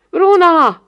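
high, loud cry of the name; shout. A narrator's reading. Boy calling after the departing girl, shout. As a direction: call after someone urgently. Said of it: "kiáltotta az ifjonc".

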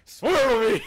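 loud, high hammed-up cry; Batman voice. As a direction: bellow it in mock climax. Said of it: "How about Batman?".

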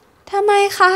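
put-on high, very slow, almost crying child's why; on the verge of tears, a lightly acted wail; childish voice close to crying; near-tears little girl. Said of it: "ทำเสียงเหมือนจะร้องไห้".

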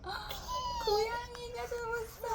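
very high squeaky cat voice; cute cat voice. Acted. Mother voices a cat in book.

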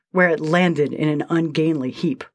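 usual speaking voice

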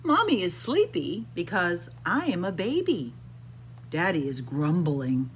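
usual speaking voice

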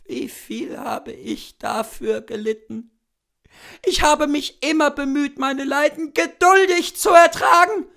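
high, loud, pleading sick woman; a feverish invalid pouring out her heart; dying sick woman. A narrator's reading. Sick wife talking to her cousin, high and loud.

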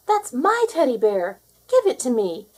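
put-on child calling after the thief, loud and insistent. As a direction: call out firmly to get the bear back. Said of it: "I called".

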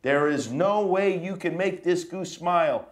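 lively, teasing storybook read; reading to children. Acted. Dad reading the book to his kids, playful dare. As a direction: dare the kids to make him laugh.

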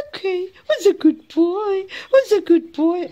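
high, swooping pet coo; loving baby talk. Shown as a demonstration. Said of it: "so that's what he likes".

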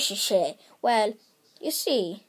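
usual speaking voice

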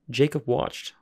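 ordinary delivery

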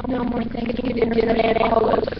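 mildly woeful bat; sad. A narrator's reading. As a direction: mourn it in a small bat voice. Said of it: "shaking his head sadly".

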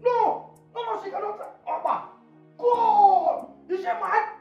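high-pitched, hammed-up horror; over-the-top wailing indignation; outraged disbelief. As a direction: cry out in scandalized disbelief. Horrified at Opa being thrown away.

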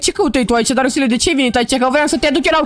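low, dry voiceover telling viewers what happened; deep commentator voice. Acted. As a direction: drop into a deep narrator voice and tattle on Darusel. Deep voice calling Darusel.

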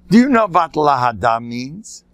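put-on heavy Yiddish-accented rabbinic question; Rav Hutner. Rabbi questioning someone on the phone, thick Yiddish accent. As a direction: lean hard on the Yiddish accent. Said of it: "With an accent".

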